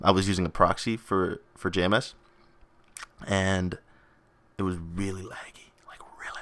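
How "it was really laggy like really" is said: hushed, voiceless whisper. Real, not acted. Vlogger confiding in viewers about game lag, whispered. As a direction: whisper it like a secret. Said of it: "I don't know why I whispered that".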